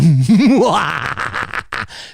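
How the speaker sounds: hammed-up evil laugh; big theatrical cackle trailing away. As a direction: burst into a long mock-maniacal cackle. Mock villain laugh after boast.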